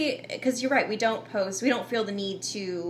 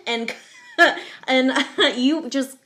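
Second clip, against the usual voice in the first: laughing; 4 semitones higher, 7 dB louder, slower than usual. Real, not acted. Laughs on these words.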